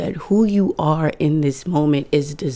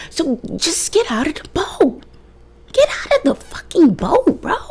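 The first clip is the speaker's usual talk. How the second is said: high, half-whispered urging; mock urgent plea; 13 semitones higher than usual. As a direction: coax them out, half whispering. Urging listener out of the boat.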